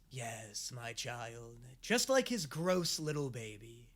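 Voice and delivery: low, hushed mentor figure; very soft, low mock-sinister reply; imitated Shadow Weaver. Sorceress confides in Catra about Hordak, imitated in a low hush.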